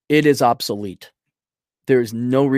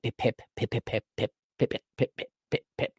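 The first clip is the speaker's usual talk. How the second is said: soft, clipped little pips, ever quieter; imitated scale ticks; 8 dB quieter than usual. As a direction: tick the scale weight along in tiny soft pips. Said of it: "little pip".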